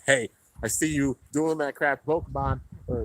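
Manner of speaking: normal talking voice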